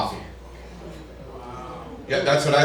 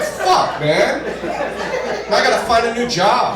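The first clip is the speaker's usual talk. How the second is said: high-pitched comic dismay; mock dismay; 7 semitones higher than usual. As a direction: react in a high, wounded yelp. Reacts to insulting comment in bit.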